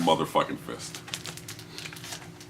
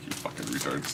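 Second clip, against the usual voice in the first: whispered, under the breath; soft whispered jab; whispered aside; 3 semitones lower, 9 dB quieter than usual. Real, not acted. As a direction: toss it off in a whisper. Muttered aside after laugh.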